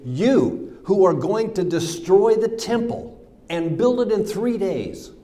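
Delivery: high, loud mocking jeer; hurled insult. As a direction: throw the taunt up loud and high. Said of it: "hurled insults at him".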